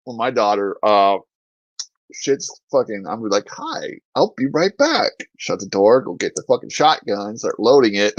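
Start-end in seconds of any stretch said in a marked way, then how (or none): 3.5-5.1 high, mock-polite greeting; falsely chipper, higher-pitched excuse; fake friendly. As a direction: sing it out pretending all is fine. Imagines greeting intruder before fetching shotgun.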